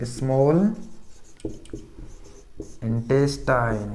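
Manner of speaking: drawn-out term with a long pause between words; teacher spacing out a term for pupils; slow dictation. Shown as a demonstration.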